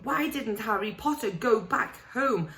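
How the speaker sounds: a fretful elf begging Harry to listen; house elf. Acted. Dobby the house elf pleading with Harry, high and anxious.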